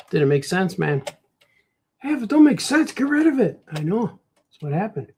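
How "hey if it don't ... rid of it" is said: high-pitched mock scolding; high nagging heckler voice. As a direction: order him about in a high put-on voice. Imagined heckler scolds the artist.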